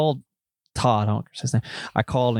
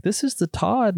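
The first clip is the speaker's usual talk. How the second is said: higher put-on character voice; prank-caller accent; 9 semitones higher, slower than usual. Prank caller insisting to salesman, high put-on accent. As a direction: lightly play the phony caller, pitched up. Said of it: "I'm doing it in my whole accent".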